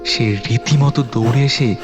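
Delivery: usual speaking voice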